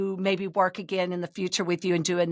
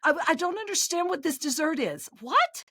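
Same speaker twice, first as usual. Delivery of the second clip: higher, mock bewildered rant, last word squeaked up high; confused frustration; 6 semitones higher than usual. Talking to the recording about the dessert, mock bafflement. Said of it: "I was like".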